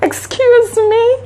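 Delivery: high, slow put-on voice with a laugh; squeaky mock voice, laughing through it; mock reply voice. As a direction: play the little guy's puzzled comeback, hammed up. She retells the man's reply.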